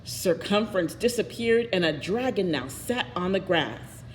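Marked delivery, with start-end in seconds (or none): none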